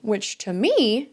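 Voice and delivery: usual speaking voice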